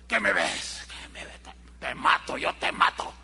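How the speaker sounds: low, hissed threat of a paranoid man; menacing neurotic. Retold or imitated. A neurotic stranger threatening whoever looks at him, menacing hiss. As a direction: growl it like a man ready to fight.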